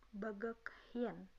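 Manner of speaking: "bagak hien" slowly, with an audible h; slow, separate words in "bagak hien"; read as written. Shown as a demonstration. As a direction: sound out "bagak hien" as spelt. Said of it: "cara bacanya dari cara menulisnya".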